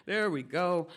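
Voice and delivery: normal talking voice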